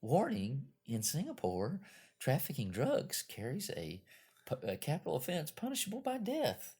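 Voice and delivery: sweet, high, soft cabin announcement; a lilting flight attendant PA voice. Retold or imitated. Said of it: "the little sweet stewardess voice comes over".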